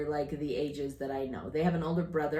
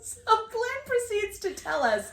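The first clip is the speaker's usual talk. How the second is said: laughing; 14 semitones higher, 6 dB louder than usual. Real, not acted. Laughing while telling giraffe story.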